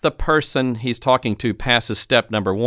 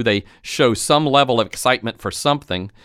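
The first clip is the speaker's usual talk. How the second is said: ordinary delivery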